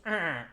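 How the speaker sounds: short, loud creature call; improvised squawk of a hippogriff; hippogriff cry. Acted. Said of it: "I don't know what hippogriffs sound like".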